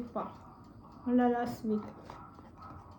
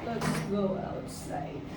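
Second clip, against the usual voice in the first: pabulong; 3 semitones lower, 3 dB quieter, slower than usual. Real, not acted. Pabulong na sabi.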